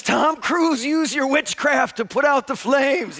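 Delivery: high, loud, frantic begging; screamed plea. Retold or imitated. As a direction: beg at a yell as if on fire. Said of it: "he's screaming".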